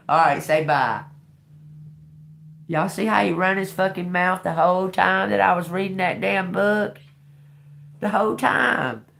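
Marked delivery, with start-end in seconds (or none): 2.7-9.1 exasperated complaint. Real, not acted. Complaining about bird's noise.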